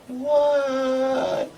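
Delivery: high, long-held answering call; Igret. Acted. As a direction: sing back a drawn-out reply. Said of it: "She said".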